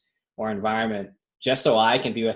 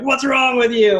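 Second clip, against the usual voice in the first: high, loud mock snap; irritated outburst; 12 semitones higher, 6 dB louder, slower than usual. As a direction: fake outrage, pitched way up and loud. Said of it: "and, like, upset at him".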